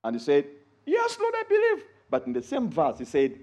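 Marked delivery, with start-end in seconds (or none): 0.8-1.8 eager believer. Acted. Man answers Jesus in scripture.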